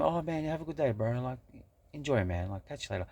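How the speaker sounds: high, breezy, over-friendly send-off; fake cheery goodbye. Shown as a demonstration. The way he won't say goodbye to a gloomy stranger, high and chirpy. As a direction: gush it like a chirpy farewell. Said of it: "I'm not saying".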